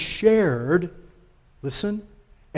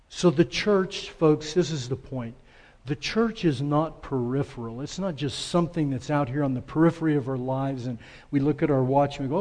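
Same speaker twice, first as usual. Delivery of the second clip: usual speaking voice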